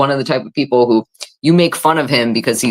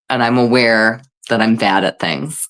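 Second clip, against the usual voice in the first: ordinary delivery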